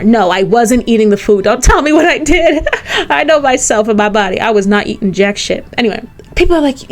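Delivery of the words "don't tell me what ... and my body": laughing. Real, not acted. Laughing retort at people's claim.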